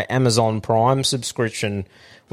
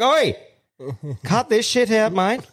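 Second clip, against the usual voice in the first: angry retort; 9 semitones higher than usual. Retold or imitated. Snapping at the scam caller.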